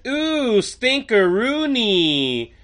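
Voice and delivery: high, slow, drawn-out mock disgust; Harley Quinn voice. Podcast host imitates Harley Quinn smelling Francis, high and slow. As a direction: stretch stinkeroonie out like a giggly sniff. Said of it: "Harley walks up to Francis, smells him, and says".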